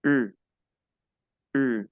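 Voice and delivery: U said as "ee" with rounded lips; two separate short French U sounds. Shown as a demonstration. Teacher repeats the U for students to practise, rounded French U. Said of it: "se obtiene colocando los labios redondos".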